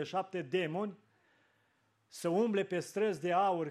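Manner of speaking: ordinary talk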